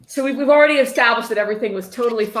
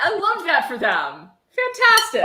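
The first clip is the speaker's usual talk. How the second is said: sarcastic high fantastic; 9 semitones higher, slower than usual. Real, not acted. Sarcastic praise of the police meeting.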